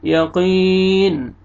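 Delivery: "यक़ीन" with long ee, ending on a still N; slow chanted "यक़ीन"; stopping on noon. Shown as a demonstration. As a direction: stretch "यक़ीन" and rest on the N. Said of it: "नून साकिन हो जाएगा".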